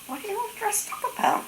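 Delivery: very high, incredulous question; baffled mother. Retold or imitated. Mother in bed to grandmother in hat and coat, high baffled question. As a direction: imitate a puzzled mother, pitched right up.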